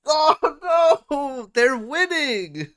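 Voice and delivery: high, loud outburst of disbelief; shocked shout. Real, not acted. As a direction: yell it out in shock. Shocked reaction to shooting news.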